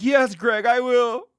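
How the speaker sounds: high, giggly mock acceptance; mock bride. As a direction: play the tearful fiancée for laughs. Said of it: "She's like".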